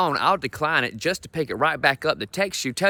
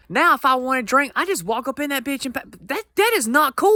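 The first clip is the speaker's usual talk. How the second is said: exasperated rant; 9 semitones higher than usual. Acted. Complaining about easy legal drinking.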